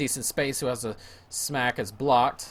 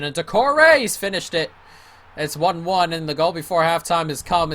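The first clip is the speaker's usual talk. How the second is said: loud, high rush as the equaliser goes in; excited goal call; 6 semitones higher, 8 dB louder than usual. Real, not acted. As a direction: shout the goal, thrilled. Goal scored in live commentary.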